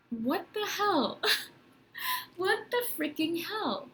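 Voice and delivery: high, drawn-out bewildered exclamation with a laugh; baffled disbelief. Retold or imitated. Thinking to herself about sudden tears on the street, high baffled disbelief. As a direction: act out laughing disbelief at yourself, stretched and high.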